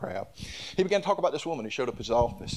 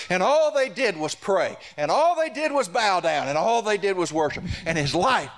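climactic preaching; 6 semitones higher, 3 dB louder than usual. Acted. Pastor bringing the testimony to a climax, fervent preaching. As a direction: let each "and all they did" rise higher.

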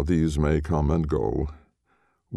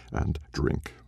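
and drink breathed away, softer; fading whisper; 5 dB quieter than usual. A narrator's reading. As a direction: let the last and drink die to a breath. Repeated drink, last one fades.

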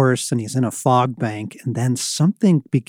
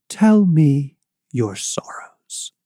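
slow, breathy, tender whisper; gentle whisper; 3 semitones higher, slower than usual. Acted. Gentle unseen presence invites confession.

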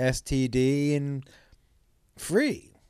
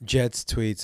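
ordinary talk, nothing marked

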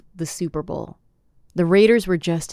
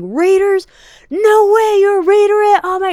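much higher, louder gushing fan voice; excited Sam; 9 dB louder than usual. Retold or imitated. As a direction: gush like a thrilled Raiders fan. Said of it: "And he's like".

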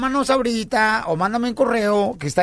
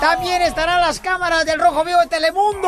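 excited radio announcer; 8 semitones higher than usual. Acted. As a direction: sell it like a radio promo.

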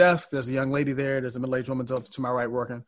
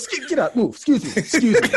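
high, pushy imitation shooing the girl aside; old clerk brushing the young worker aside, high and bossy; bossy old man; 8 semitones higher, 4 dB louder than usual. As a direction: shove her out of the way with your voice. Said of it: "He's like".

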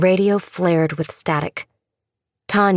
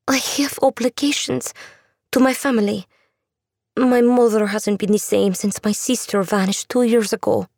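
put-on higher, earnest young woman; young woman's voice; 5 semitones higher than usual. A narrator's reading. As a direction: voice her higher and pleading. Andula voiced, refusing.

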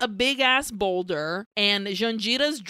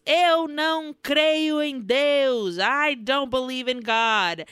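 high, drawn-out retold yell; mock defiant yell; 4 semitones higher, 4 dB louder than usual. Villain defying the mob in a mock defiant yell. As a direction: cry it out slowly and loudly, hammed-up. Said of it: "And he yells".

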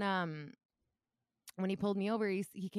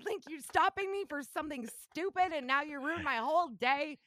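angry rant; 10 semitones higher than usual. Retold or imitated. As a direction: vent the anger at the cop. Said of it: "I was so mad".

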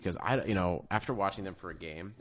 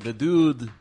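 mock Serbian accent; 4 semitones higher, 11 dB louder than usual. Co-host hamming up the GM's accent, loud Serbian voice. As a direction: bark the word in a hammed-up Serbian accent. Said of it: "I do the accent".

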